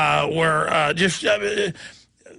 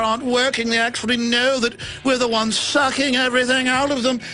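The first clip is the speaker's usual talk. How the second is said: mock royal voice; 2 semitones higher than usual. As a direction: gloat like a villainous royal. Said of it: "when I started imitating the royals".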